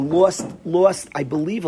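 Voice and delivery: normal talking voice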